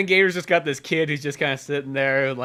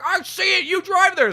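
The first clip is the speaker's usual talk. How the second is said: bossy cop; 4 dB louder than usual. Retold or imitated. Bossy cop ordering driver.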